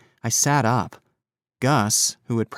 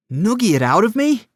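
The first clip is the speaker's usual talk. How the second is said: incredulous; 10 semitones higher, 5 dB louder than usual. A narrator's reading. Incredulous echo of threat.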